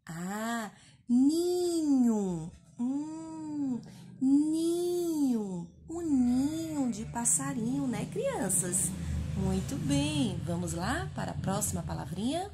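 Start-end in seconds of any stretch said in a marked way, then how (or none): 1.1-10.4 Ninho, the final o said as U. Shown as a demonstration. Teacher showing final O sounds like U. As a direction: stress ni, end on a u sound. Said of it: "modelling word to children".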